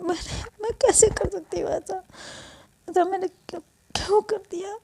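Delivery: high, tearful pleading to mom; a girl near tears, blaming herself; high and tearful, voice catching; distressed daughter. Acted. Daughter sobbing to her mother.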